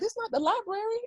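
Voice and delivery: giddy. Retold or imitated. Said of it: "I'm like".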